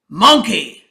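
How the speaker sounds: loud, slow, commanding lion king. A narrator's reading. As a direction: boom it out like a king addressing his court. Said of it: "said the king".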